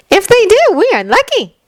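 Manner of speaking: put-on high, bright smiling girl. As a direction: say it with a smile. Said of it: "Uraraka says with a smile in her voice".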